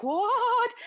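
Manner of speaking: high, loud mock disbelief; shocked wife. Retelling the wife's reply to her husband, mock shocked. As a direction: shoot the pitch up in mock shock.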